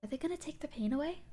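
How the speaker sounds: small, hushed, hopeful voice; frail, wistful murmur; third figure, little girl. Acted. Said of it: "the final one".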